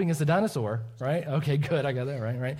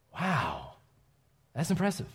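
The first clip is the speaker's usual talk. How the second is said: mock impressed wow; 4 dB quieter, slower than usual. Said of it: "the last time I went".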